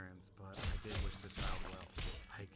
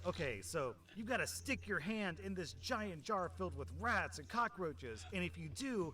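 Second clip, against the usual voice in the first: game show host; 8 semitones higher, 4 dB louder than usual. Retold or imitated. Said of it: "the ones where they're like".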